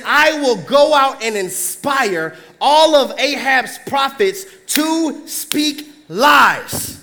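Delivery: very slow, deliberate; slow emphatic. Acted. Preacher repeating the spirit's line slowly for emphasis. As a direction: weigh each word.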